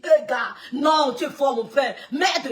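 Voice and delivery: loud laughing "tu es fort"; laughing shout. Retold or imitated. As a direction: cheer him on, half laughing. Cousin shouting praise, laughing.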